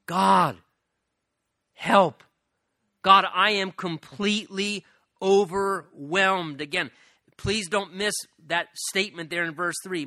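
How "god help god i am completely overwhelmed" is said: loud, slow plea in separate bursts; passionate crying out. Acted. Preacher voicing the psalmist crying out to God, passionate. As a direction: call out to God for help, acted with force. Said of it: "to cry out implies passionate".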